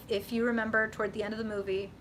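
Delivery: usual speaking voice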